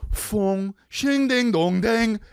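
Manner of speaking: high vocal dings and dongs; imitated intro noises. Mimicking the episode's opening, high sound effects. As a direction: sound out the theme.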